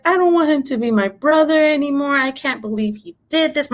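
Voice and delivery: high-pitched heartbroken child imitation; upset son. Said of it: "My heart broke".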